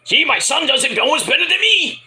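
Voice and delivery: high, hammed-up Mexican-American accent; Eddie Guerrero. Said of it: "like Eddie Guerrero".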